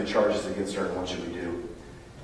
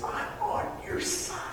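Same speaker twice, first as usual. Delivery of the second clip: hushed reassurance; 7 dB quieter, slower than usual. Acted. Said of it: "he looks at her and says".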